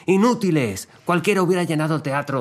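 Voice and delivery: hammed-up scolding at high pitch; imitating angry shouting. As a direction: berate them like a furious voice note. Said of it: "mandó una nota de audio gritando".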